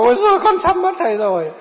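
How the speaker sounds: high, loud mock wail; exaggerated high-pitched lament; imitated crying. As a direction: wail it out, imitating a distraught woman. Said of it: "Cứ thế là khóc".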